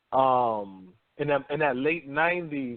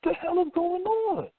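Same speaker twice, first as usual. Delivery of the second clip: high, indignant cry of confusion; outraged disbelief; 12 semitones higher than usual. Retold or imitated. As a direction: protest that it sounds all wrong. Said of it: "I said".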